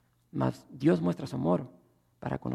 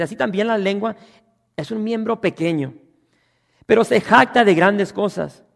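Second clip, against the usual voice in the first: higher, louder preaching voice; full-force preaching; 6 semitones higher, 12 dB louder than usual. Acted. Pastor drives the point at the congregation, loud. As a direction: hammer home that everyone fails.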